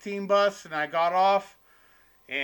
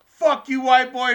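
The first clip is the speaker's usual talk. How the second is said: higher, louder jeering shout; retold heckler yell; 7 semitones higher, 9 dB louder than usual. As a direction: jeer at the comic from the crowd. Heckler yells at comic.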